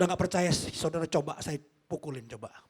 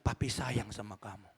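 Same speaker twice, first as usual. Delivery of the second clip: low, soft fatherly voice; tender fatherly affection; 8 semitones lower, 6 dB quieter than usual. Shown as a demonstration. Father hugging departing son, low tender declaration. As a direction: lower and soften it, quietly loving. Models loving words for parents.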